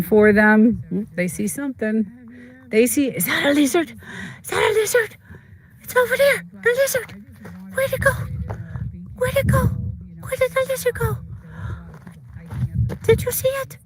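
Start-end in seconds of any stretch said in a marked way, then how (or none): 2.7-13.8 high, squeaky voice of an eager dog sniffing out a lizard; excited dog voice. Pretend. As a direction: play the dog, wide-eyed and searching. Voicing the dogs hunting a lizard.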